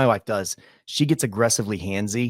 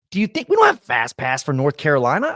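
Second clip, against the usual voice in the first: ordinary delivery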